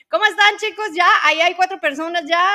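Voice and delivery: high and loud, calling out to chat; excited greeting. Real, not acted. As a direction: greet everyone brightly. Cheerful greeting to viewers.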